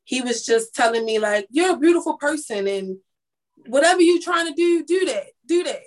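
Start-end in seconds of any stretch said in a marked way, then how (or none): 1.5-5.8 urging encouragement. Retold or imitated. Stranger on the bus stop urges her, rising to a shout.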